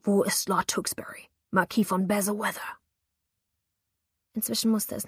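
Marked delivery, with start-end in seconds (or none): 0.0-2.8 menacing rasping whisper; hissed demand. Acted. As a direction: hiss it like a threat. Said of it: "zischte er".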